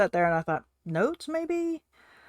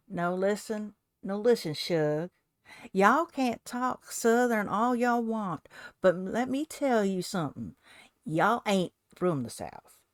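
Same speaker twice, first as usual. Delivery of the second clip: drawled Southern accent, slow stretched vowels; slower than usual. Shown as a demonstration. Crafter jokingly showing viewers her Southern talk, Southern accent. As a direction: lay on a thick Southern drawl. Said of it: "to put that in a Southern accent".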